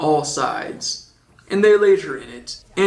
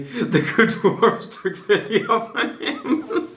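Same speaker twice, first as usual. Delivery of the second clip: high, slow line dissolving into laughs; cracking up while reading; breaking into laughter; 7 semitones higher, slower than usual. Real, not acted. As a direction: lose it mid-sentence. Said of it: "Per-, perfect voice for that".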